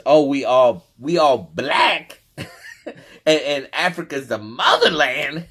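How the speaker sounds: loud, high mock-naive quote breaking into laughter; mocking enthusiasm. Ridiculing a brother's belief in the motherland, mocking enthusiasm. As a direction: play up starry-eyed belief, half laughing.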